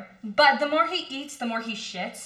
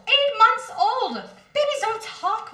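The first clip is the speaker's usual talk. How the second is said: exasperated outburst; 12 semitones higher, 5 dB louder than usual. Acted. Mother yells at father that babies can't talk, exasperated. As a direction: throw the words back at him in disbelief.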